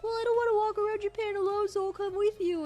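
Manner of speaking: high, fast put-on mom voice; mock mom voice. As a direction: play the mom, high and quick. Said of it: "my mom would probably be like".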